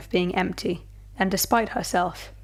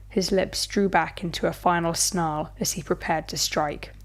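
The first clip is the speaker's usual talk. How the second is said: plain reading voice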